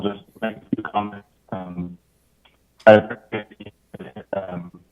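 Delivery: audio cutting in and out; choppy connection dropouts; uneven volume. Real, not acted. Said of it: "your audio is cutting out".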